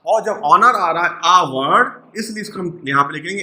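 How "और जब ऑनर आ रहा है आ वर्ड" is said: ordinary talk, nothing marked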